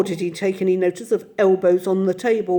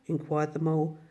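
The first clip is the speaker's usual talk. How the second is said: ordinary delivery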